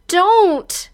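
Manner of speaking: high, loud single-word cry. Acted. Heroine crying out to her captor, high and loud. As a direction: blurt it high as its own phrase. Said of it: "also cries out".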